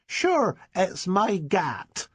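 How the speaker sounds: put-on high boy's voice in broad Dorset, slow gangster swagger; a Dorset boy aping a movie gangster; strong Dorset accent. A narrator's reading. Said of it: "with a strong Dorset accent".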